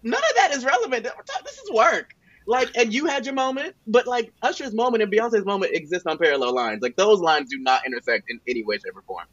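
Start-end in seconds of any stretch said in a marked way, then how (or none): 0.0-6.8 high mock businesswoman.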